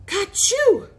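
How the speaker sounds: high, loud acted "achoo"; mimicked sneeze. A narrator's reading. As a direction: burst out with a fake sneeze. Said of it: "all of a sudden he'd sneeze".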